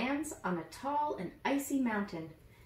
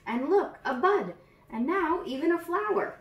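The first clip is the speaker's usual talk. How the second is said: building excitement, higher and louder; growing storybook amazement; delighted wonder; 9 semitones higher, 5 dB louder than usual. Acted. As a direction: gasp with joy as the flower appears. Marvelling at the flower opening.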